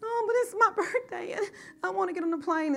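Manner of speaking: high, tearful plea from a crying daughter; mock tearful daughter's plea; tearful child. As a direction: whine it like a teary little girl. Daughter crying on her birthday.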